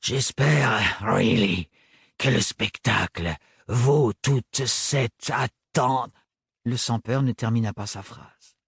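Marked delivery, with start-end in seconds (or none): 0.0-6.1 exasperated sneer. Acted. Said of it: "le soupir exaspéré".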